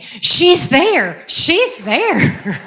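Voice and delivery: high, loud, jubilant insistence; imitating father's exultant repetition. Daughter imitating her healed father, exultant and loud. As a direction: cry it out with pitch leaping up. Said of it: "my father just kept saying".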